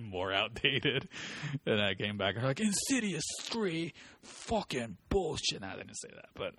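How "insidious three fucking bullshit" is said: high, slow, drawn-out indignation; mock outrage. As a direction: stretch each word in hammed-up disgust. Exaggerated retold customer outrage.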